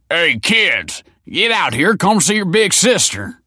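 loud, high summons; yell. A narrator's reading. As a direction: holler for the kids to come. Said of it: "yelled Tony".

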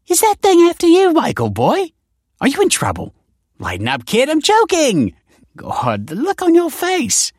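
very high, loud, swooping auntie voice; crowing auntie, teasing. Acted. Auntie jokes about the machine after Michael, teasing crow. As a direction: tease the kid in a high, loud voice. Auntie teases her nephew.

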